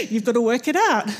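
laughing through the words; amused shrug of a reply. Retold or imitated. Turkish friend telling missionary to work it out, laughing.

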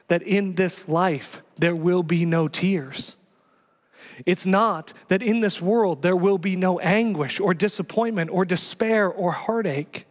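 ordinary talk, nothing marked

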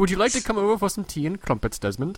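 high, put-on polite English accent; British accent. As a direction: invite them to tea in a hammed-up British voice. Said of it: "He did a much better one".

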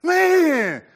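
loud, high frustrated yell at the game; mock the son's gaming rage; raging gamer son. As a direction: kid shouting at Fortnite. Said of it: "he'll just be like".

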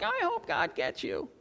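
high, soft mock grudge; mock ill-wishing. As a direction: wish it on them in a small, high voice. Mocking the wrong attitude.

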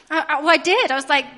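ordinary delivery

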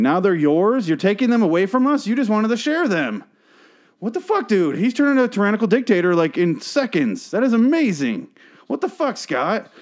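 high-pitched, incredulous protest climbing as it goes; outraged disbelief. Acted. Outraged at Scott's betrayal.

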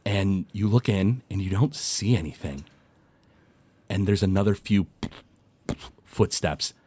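soft, quiet storytelling; quiet game master narration; hushed scene narration. A narrator's reading. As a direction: draw them into the creepy moment. GM sets eerie scene.

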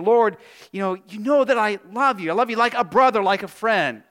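eager, emphatic protest of affection; earnest Peter. Acted. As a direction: insist on your brotherly love. Acting Peter's earnest answer to Jesus.

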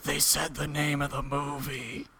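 hushed movie-theater whisper; whispered aside. Retold or imitated. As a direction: lean over and whisper it. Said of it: "be like".

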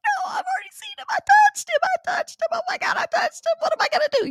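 very high, frantic squealing; hammed-up hysterical woman, voice way up; Lona. Coworker who touched the body, hysterical squeal. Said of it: "She's like".

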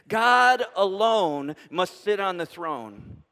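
slow, deliberate words recited with the congregation; slow unison recital. Shown as a demonstration. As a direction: lead the room through it word by word. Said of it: "Would you say that with me?".

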